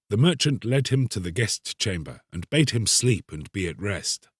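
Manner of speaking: usual speaking voice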